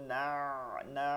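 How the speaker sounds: না drawn back into the throat; throaty ain. Shown as a demonstration. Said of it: "আইনটাকে ভিতরে নিয়ে যেতে হবে".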